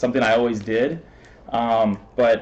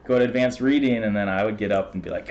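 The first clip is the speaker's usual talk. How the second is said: ordinary talk, nothing marked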